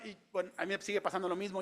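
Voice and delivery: normal talking voice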